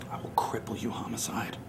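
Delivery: menacing whisper. Acted. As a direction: whisper it like a promise of pain. Threat to his rival.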